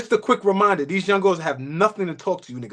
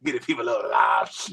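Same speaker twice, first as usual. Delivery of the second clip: lower, fast, shouted raspy impression of Keith; raspy voice imitation; 10 semitones lower, 4 dB quieter, faster than usual. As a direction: bark it out in his raspy voice, fast. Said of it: "with the raspy voice".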